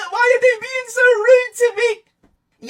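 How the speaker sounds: high, slow fake-crying whine; mock crying. Mocking someone bullied online, fake tearful whine. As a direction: whine it like a pretend crybaby. Mocks a crying cyberbullying victim.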